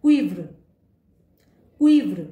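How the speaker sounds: क्विव्र said loudly and clearly, kwee-vr; loud demo of French "cuivre", क्विव्र. Shown as a demonstration. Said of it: "एक और example देखते हैं।".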